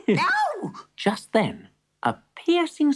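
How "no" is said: alarmed cry. Acted.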